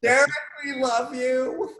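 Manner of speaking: high, slow gushing shout-out. Real, not acted. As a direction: sing out your love to a friend. Affectionate shout-out to friend.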